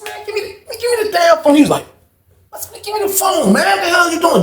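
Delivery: high-pitched retold exasperation; exasperated demand. Retold argument over the phone.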